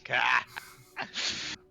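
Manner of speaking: slow, lofty gloating villain. Acted. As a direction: lord it over puny mortals.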